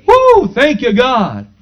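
rally the congregation with exultant praise; fervent preaching. Real, not acted. Preacher calling church to lay hands and pray, fervently.

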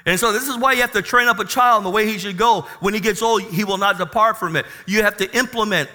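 loud, high, fast preaching; rally the congregation about the new body; full-throated sermon climax; preaching at full force. Acted.

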